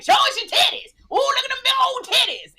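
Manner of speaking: loud mocking crowd catcall; imitating rowdy hecklers. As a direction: hammer it like a crude heckler in the crowd. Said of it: "people's always like".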